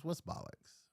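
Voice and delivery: breathy whisper, no voice; whispered question. Real, not acted. Checking the word's meaning, whispered aside.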